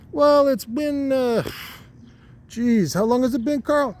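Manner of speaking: a squeaky little guy stalling to think; talking testicle. Acted. A high-voiced testicle asking his buddy Carl, slowly. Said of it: "And he's like".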